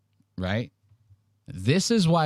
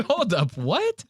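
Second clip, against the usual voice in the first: stunned double take; 9 semitones higher, slower than usual. Retold or imitated. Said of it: "I go".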